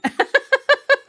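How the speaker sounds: very high, loud delighted squeal; squeal of laughter. Real, not acted. Reader declares herself a fan, high loud squeal. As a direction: squeal with joy.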